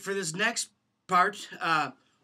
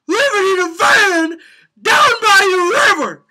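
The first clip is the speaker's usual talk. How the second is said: high, loud, straining hammed-up speaker voice; frantic motivational speaker; 9 semitones higher, 8 dB louder than usual. Shown as a demonstration. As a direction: lean in and holler at Uncle Dave. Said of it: "the inflection on van down by the river".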